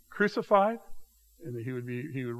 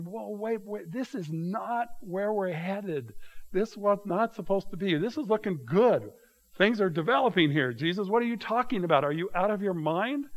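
higher-pitched imagined disciples objecting; incredulous protest; 5 semitones higher than usual. Acted. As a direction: protest in disbelief, pitched up, as if acting out stunned followers.

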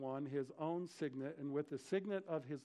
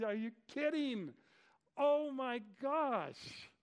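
high, drawn-out cry of disbelief; flipped-out disbelief; 8 semitones higher, 4 dB louder, much slower than usual. Acted. Preacher playing a king stunned to hear Daniel alive, flipped out. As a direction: flip out, stretching the words way up high. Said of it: "he has got to be flipped out".

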